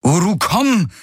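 high, impatient command; losing self-control. A narrator's reading. Kirai orders Uru to come, losing control. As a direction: order it with patience gone, pitch up. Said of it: "wiederholte sie unbeherrscht".

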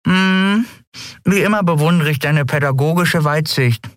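high mumble through a mouthful; a touch of full-mouthed mumbling. A narrator's reading. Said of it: "nuschelte mit vollem Mund".

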